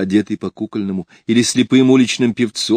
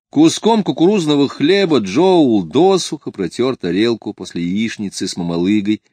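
usual speaking voice

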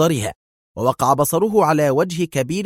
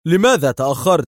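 loud, higher angry demand; sharp furious question; angry sharpness; 6 semitones higher, 7 dB louder than usual. A narrator's reading. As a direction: confront him for being late. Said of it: "وقال في حدة غاضبة".

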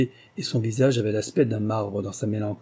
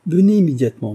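higher, louder summons; commanding call; 6 semitones higher, 8 dB louder, faster than usual. Acted. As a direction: call him over at once. Hostess summons the palmist.

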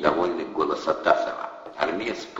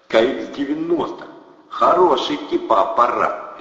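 higher, loud gangster boast; boastful thug; 7 semitones higher, 6 dB louder than usual. A narrator's reading. Thug shows off the fax, loud and boastful. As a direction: show off the gadget.

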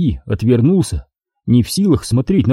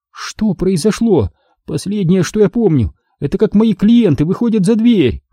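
Astri voiced high; 8 semitones higher than usual. A narrator's reading. Dazed daughter asks her father what happened, pitched high.

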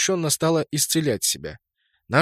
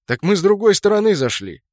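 higher, louder annoyed realization; indignant; 7 semitones higher, 5 dB louder than usual. Acted. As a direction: exclaim in frustrated realization. Kraken indignant at wrong side.

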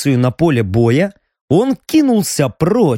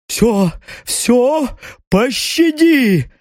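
rasping begging, higher and slow; wheezing plea; 8 semitones higher, much slower than usual. A narrator's reading. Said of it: "просипел ушибленный кувшином".

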